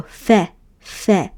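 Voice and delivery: fe said as one syllable; teacher blending fe; blended fe. Shown as a demonstration. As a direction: join f and eh into fe. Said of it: "And you go".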